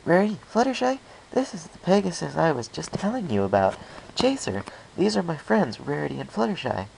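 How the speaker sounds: high, bouncy party-pony chatter; bubbly Pinkie voice. Acted. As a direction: introduce your friends at a happy high pitch.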